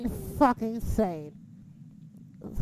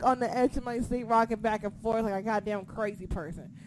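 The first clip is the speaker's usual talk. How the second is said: normal talking voice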